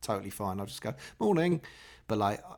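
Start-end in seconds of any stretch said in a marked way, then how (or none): 1.2-1.6 higher and louder sing-song morning; cheery morning. Retold or imitated. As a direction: toss out a friendly greeting. Greeting people where he lives.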